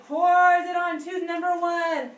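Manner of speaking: booming slow storyteller shout; dramatic shout. Acted. Telling kids the plaque attacks the tooth, booming shout. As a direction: roar it out to scare the kids playfully.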